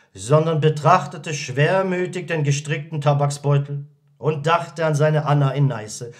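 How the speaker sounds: ordinary delivery, nothing marked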